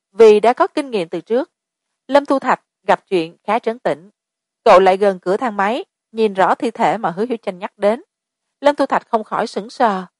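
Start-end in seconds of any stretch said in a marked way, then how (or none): none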